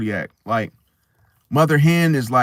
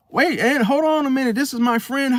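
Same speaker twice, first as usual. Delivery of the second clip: higher-pitched mock protest; imitated nephew; 11 semitones higher than usual. Nephew stalling his aunt to introduce a friend, pitched up. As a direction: play the nephew trying to hold his aunt off. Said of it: "And then you like".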